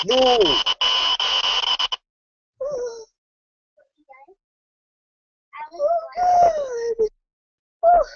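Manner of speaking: high shrieking panic; terrified scream. Real, not acted. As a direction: shriek in real terror. Jump scare in horror game.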